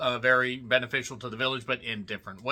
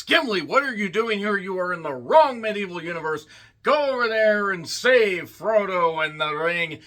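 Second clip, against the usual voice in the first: higher, hammed-up exclamation; mock scolding; 6 semitones higher, 4 dB louder than usual. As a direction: scold a stray hero in playful outrage. Mock scolding of a stray film character.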